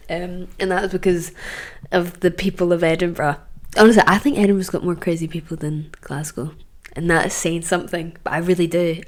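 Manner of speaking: ordinary talk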